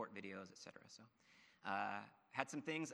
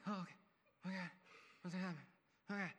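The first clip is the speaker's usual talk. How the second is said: high, broken mock whimper; imitated whimpering; 6 semitones higher, much faster than usual. Wife freaking out behind her husband at knifepoint, imitated whimper. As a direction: whimper in little fragments, imitating panic. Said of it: "just whimpering".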